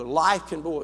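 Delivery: ordinary delivery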